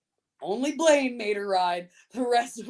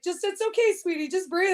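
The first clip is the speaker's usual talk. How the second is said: higher, soothing voice telling her to breathe; a cowboy mock-soothing a hurt rider; calming cowboy; 10 semitones higher, 3 dB louder than usual. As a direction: coax her to calm down, pitched up.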